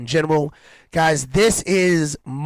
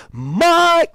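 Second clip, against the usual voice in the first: big announcer yell; 13 semitones higher, 4 dB louder than usual. Pretend. No, I'm just playing.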